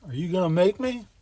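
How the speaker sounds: know-it-all apprentice lightly yelling back; cocky yell back. A narrator's reading. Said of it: "know-it-all apprentice Jimmy yells back".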